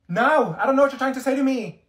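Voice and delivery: loud, high exasperated outburst; exasperated yell. Acted. Friend yelling at a muddled explanation. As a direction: blurt it out in frustration.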